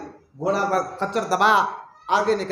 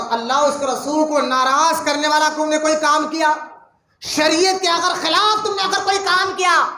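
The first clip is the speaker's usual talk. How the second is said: stern proclamation; 6 semitones higher, 6 dB louder than usual. Acted. Umar's first sermon, stern warning.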